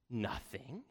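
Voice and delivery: quiet, questioning "Nothing?" with a rise; taken aback. A narrator's reading. King echoing his daughter's answer, taken aback. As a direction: echo "Nothing?" back as a soft, disbelieving question. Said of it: "he exclaimed".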